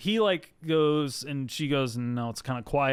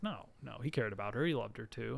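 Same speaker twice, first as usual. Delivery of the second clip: soft confession; 9 dB quieter than usual. Retold or imitated. [name] confessing softly.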